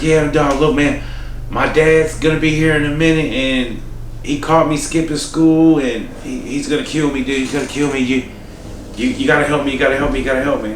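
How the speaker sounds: panicked pleading voice. Retold or imitated. Coworker begs for help, retold.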